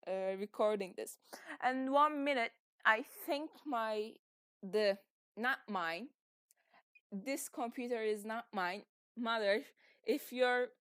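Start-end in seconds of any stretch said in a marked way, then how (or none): none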